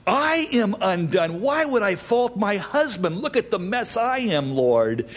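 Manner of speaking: high, pleading lament; acted cry of self-reproach. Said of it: "as Isaiah cried".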